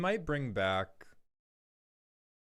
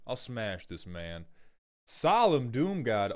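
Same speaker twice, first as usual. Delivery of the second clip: extremely bad Benoit Blanc accent; Foghorn Maldhorn. Streamer giving a viewer a taste test, bad Benoit Blanc accent. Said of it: "I do an extremely bad Benoit Blanc accent".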